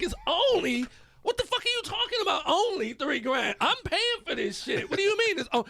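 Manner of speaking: high-pitched disbelief, rising fast; incredulous outburst. Retold or imitated. Father scolding son over three grand, incredulous. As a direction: blow up at the price in mock outrage. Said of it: "I'm like".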